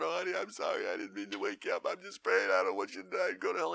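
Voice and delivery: lightly voiced pleading wife; wife praying by the bed, lightly voiced plea; tearful wife. Wife answering husband.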